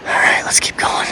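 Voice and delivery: breathy hushed whisper. Real, not acted. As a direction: whisper so nothing hears you. Whispered while stalking.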